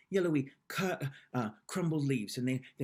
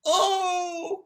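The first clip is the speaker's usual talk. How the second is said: high loud shocked oh; shocked cry; 10 dB louder than usual. Acted. Annie finding muck in her pocket, crying out. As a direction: cry out in disgust. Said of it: "cried Annie".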